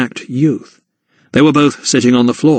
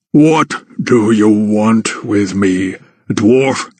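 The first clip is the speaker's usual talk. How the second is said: slow, lower giant's voice; booming giant; 3 semitones lower, much slower than usual. A narrator's reading. Giant questions the dwarf, slow booming voice. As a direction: shape each word slowly, like thunder. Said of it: "boomed the giant".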